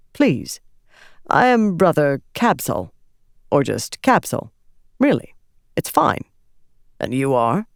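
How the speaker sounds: put-on refined young man, light and friendly; young ardent introducing himself warmly. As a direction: introduce yourself easily and kindly.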